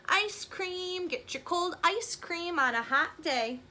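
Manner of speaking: lightly voiced ice cream seller calling out; a hint of a cheery vendor's cry; penguin vendor call. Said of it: "The penguin says".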